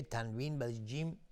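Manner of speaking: ordinary talk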